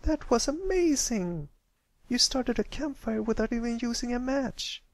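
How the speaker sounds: put-on high young filly voice full of wonder; a kid gushing over a trick; young filly, amazed. Apple Bloom praising Pipsqueak's campfire, high and amazed.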